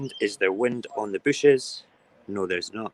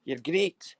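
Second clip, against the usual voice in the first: loud, high, slow-drawn call; shout out the window; 10 semitones higher, 8 dB louder, much slower than usual. Acted. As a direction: bellow it to the bin men outside. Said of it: "I'm gonna shout at them and say".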